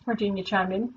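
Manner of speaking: plain reading voice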